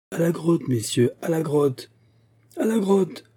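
high, excited rallying call; rallying cry. A narrator's reading. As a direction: urge the whole group onward. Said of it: "répétèrent toutes les voix".

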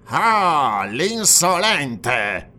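loud, drawn-out scornful jeer; sneering. A narrator's reading. Police prefect sneering at the defiant barbarian, drawn out. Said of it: "sogghignò un compagno di Demetrio".

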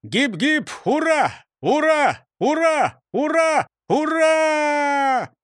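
high loud hip-hip hurrah; cheering crowd. A narrator's reading.

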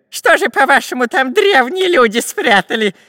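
high, loud mocking question; scoffing robber. A narrator's reading. Robber ridiculing the professor's doubt, scoffing. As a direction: jeer it, heading into a giggle. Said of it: "засмеялся разбойник".

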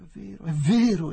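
high, loud, relieved exclamation; great relief. A narrator's reading. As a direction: burst out with relief. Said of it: "esclamò Adela con grande sollievo".

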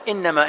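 إنما run together as one word, "innama"; said as joined. Shown as a demonstration. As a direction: link inna and ma with no gap in إنما. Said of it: "وأحيانًا متصلة".

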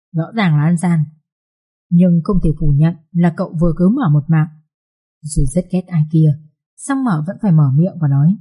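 ordinary delivery

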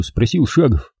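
ordinary delivery

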